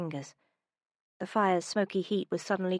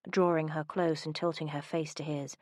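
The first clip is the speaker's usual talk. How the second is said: plain reading voice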